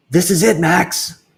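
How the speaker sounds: a crook savoring the big job; sneak thief. Acted. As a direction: thief Luther telling his partner Max it's time. Thief character voice.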